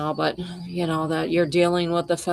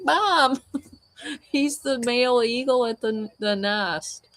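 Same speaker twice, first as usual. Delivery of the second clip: much higher, laughing through it; laughing explanation; 7 semitones higher than usual. Retold or imitated. Explaining to her mom that Ozzie is the eagle, high and laughing. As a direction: explain it as if it's obvious, giggling. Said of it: "I'm like".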